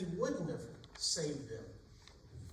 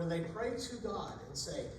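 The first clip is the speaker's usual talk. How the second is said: ordinary talk, nothing marked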